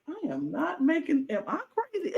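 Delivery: high, soft, doubting yourself; bewildered. Retold or imitated. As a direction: Ross questioning his own sanity, high and bewildered. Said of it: "Ross is like".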